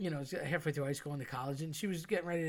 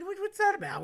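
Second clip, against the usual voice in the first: incredulous retold question; 6 dB louder than usual. Friend baffled by the salt-over-shoulder habit, high incredulous squeak. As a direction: pitch leaps way up, louder, baffled.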